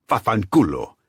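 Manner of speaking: loud, high Italian curse; angry curse. Acted. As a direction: spit the curse.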